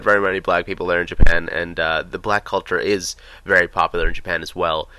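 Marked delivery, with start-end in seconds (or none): none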